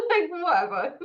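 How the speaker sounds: giggling through a dismissive whatever; laughing. Real, not acted. Laughing it off to the host about London. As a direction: let the laugh carry the shrug.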